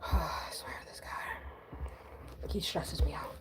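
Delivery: slow, breathy whispered complaint; exasperated whisper. Real, not acted. Complaining to viewers about her cousin, slow whisper. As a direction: sigh it out under your breath.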